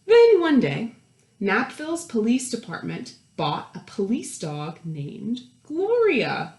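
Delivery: put-on lively read-aloud voice for children; animated picture-book narrator; storybook narration. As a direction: read it like story time to a class.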